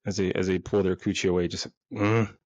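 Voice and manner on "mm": cave woman grunt. Retold or imitated. Woman refusing her partner with a cavewoman grunt. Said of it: "grunting like cave women".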